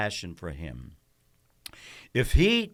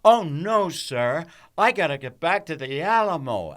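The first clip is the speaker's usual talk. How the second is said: higher and louder, singing it out; big theatrical read; 7 semitones higher, 7 dB louder than usual. Shown as a demonstration. Actor shows the director's version, high and loud. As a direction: belt the line like a stage hero. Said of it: "here's how I had to read it for him".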